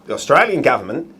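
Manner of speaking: put-on Aussie accent; Australian accent. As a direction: try out an amateur Australian accent. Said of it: "he's gonna try and be Australian".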